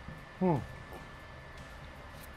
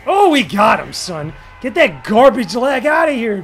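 loud triumphant shout; 4 semitones higher, 7 dB louder than usual. Real, not acted. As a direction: crow over the win. Wins round.

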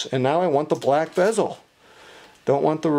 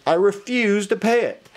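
higher-pitched mock-defiant customer; mildly acted defiance, pitched up; indignant refusal; 6 semitones higher than usual. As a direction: imitate a friend standing his ground.

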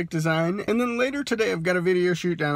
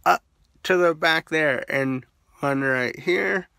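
lips pulled back by fingers; talking while showing teeth; fingers in mouth. Real, not acted. Seen 0: fingers pull lips and cheek back, showing braces throughout.

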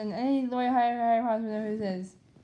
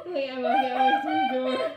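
laughing; 8 semitones higher, 8 dB louder than usual. Real, not acted. Laughing fit after pillow mishap.

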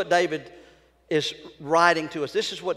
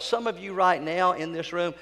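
usual speaking voice

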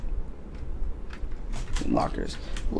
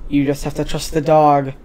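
ordinary delivery

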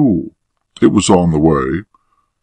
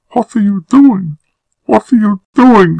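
very high, loud horrified woman; cry of horror; 6 dB louder than usual. A narrator's reading. Woman as her lover kisses her, crying in horror. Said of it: "she cried in horror".

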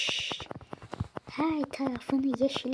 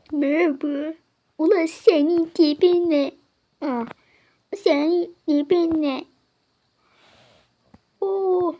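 much louder, higher jeering at his looks; loud astonished greeting; 4 semitones higher, 9 dB louder than usual. Acted. Bouncer mocking a weird customer's look, loud. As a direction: blurt it out at his strange face.

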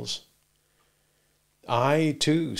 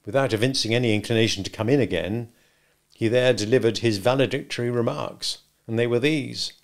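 plain reading voice

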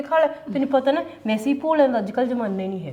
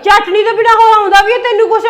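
higher and louder, laughing at her; mocking laugh; 7 semitones higher, 8 dB louder than usual. Sister mocks sister who only makes chutney, laughing. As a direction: jeer while laughing.

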